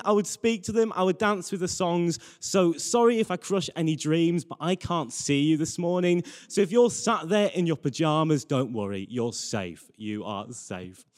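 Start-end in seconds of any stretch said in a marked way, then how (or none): none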